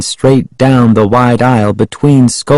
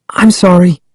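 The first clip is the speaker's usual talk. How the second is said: higher-pitched, guilty apology; a girl's sheepish sorry, pitched up; hasty apology; 8 semitones higher than usual. A narrator's reading. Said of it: "Sari said quickly".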